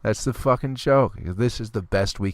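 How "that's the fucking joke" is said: normal talking voice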